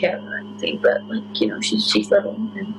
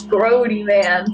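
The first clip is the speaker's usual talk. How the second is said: ordinary talk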